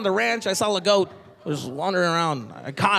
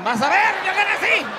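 higher, gleeful threat with a laugh; taunting parent; 12 semitones higher than usual. Retold or imitated. Dad warning son about Mexico, gleeful. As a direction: tease him about what's coming.

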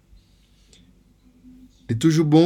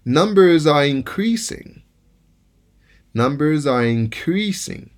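increasing, slow, stressed on CREAS; verb stress on CREAS; 4 semitones higher, slower than usual. Shown as a demonstration. Teacher reading the verb example, slow, stress on CREAS. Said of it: "Lòske se yon vèb".

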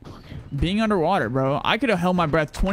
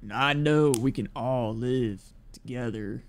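Rick Grimes impression; 5 semitones lower, 4 dB quieter, slower than usual. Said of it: "Do a Rick Grimes accent".

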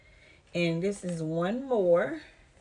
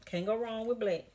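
ordinary talk, nothing marked

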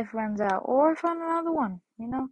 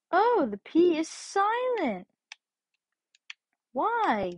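Sonic impression; 2 semitones higher than usual. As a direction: play the hedgehog wide-eyed and amazed. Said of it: "voice myself as Sonic".

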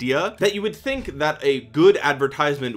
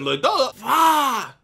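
imitating a put-on Japanese accent, higher, ending in a shout; mock Japanese accent; 8 semitones higher, much slower than usual. Mocking the hired voiceover guy, exaggerated Japanese accent. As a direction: burst into a hammed-up Japanese warrior cry. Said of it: "he just puts on this accent".

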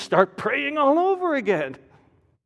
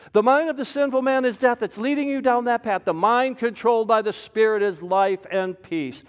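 usual speaking voice

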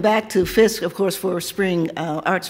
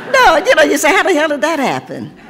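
high, loud, laughing "how the hell"; laughing disbelief; 8 semitones higher, 6 dB louder than usual. Acted. Poet marvelling that her student is forty-six, laughing high and loud. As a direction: blurt it out, amazed and laughing.

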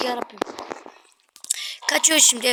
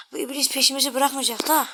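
ordinary delivery

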